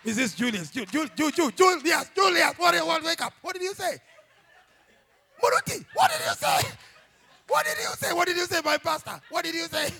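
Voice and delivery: shouting wife waking her husband; mock panicked wife yelling. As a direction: shake him awake and demand answers. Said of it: "your wife ... she wonders".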